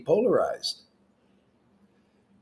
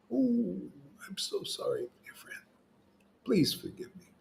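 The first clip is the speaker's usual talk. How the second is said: mock hushed apology; 5 semitones higher, 10 dB quieter than usual.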